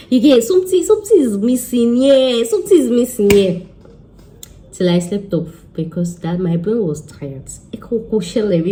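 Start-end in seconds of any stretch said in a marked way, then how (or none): 0.1-3.6 frustrated. Retold or imitated. Stressed working overnight.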